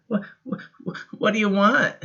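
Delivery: put-on high, stammering frightened boy; a startled boy stuttering in the night; scared child. Boy woken by the dark asking what it wants, scared.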